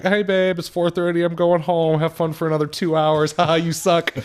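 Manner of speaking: high teasing singsong; teasing mock wife. Imitating his wife leaving early, high teasing. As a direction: tease him high on the way out. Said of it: "She's like".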